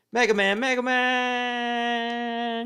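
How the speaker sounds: very high, long-held wailing cry; hammed-up anguished scream. Hero losing Mega Man, drawn-out high mock-anguished scream. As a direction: scream the name in melodramatic despair.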